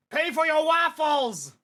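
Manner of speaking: high, loud, drawn-out yell; slow, high cartoon war cry; battle shout. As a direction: shout it like an attack move. Waffle shop fighter attacks.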